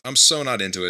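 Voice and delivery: ordinary talk, nothing marked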